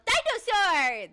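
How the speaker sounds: high, loud, slow joyful yell. Retold or imitated. Retelling yelling while opening the package, loud and slow. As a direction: stretch it out in a delighted yell. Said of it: "opened it and yelled".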